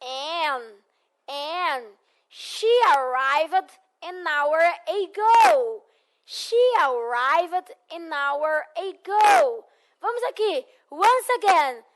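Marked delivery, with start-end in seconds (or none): 2.3-5.8 "She arrived an hour ago" said very slowly, linking "an hour"; slow model sentence. Shown as a demonstration. Teacher models the sentence slowly for the class. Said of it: "o que que eu coloco antes? An". 6.2-9.7 "She arrived an hour ago" said very slowly, linking "an hour"; slow model sentence. Shown as a demonstration. Teacher models the sentence slowly for the class. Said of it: "o que que eu coloco antes? An".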